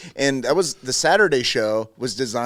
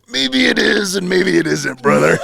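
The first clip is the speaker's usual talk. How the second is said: higher, louder wrestling promo voice; Macho Man impression; 6 semitones higher, 5 dB louder, slower than usual. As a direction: ham it up like a wrestler cutting a promo. Macho Man quoted in retold story.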